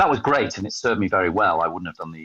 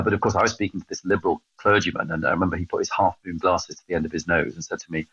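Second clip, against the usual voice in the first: ordinary delivery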